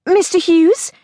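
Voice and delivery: rising call of Mister Hughes? into a cut-off line; calling down the phone. A narrator's reading. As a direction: ask Mister Hughes? with a lifting question.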